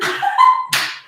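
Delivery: squealing laugh; high shriek laugh. Real, not acted.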